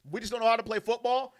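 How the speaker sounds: usual speaking voice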